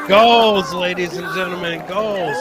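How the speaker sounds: hyped announcer. Real, not acted.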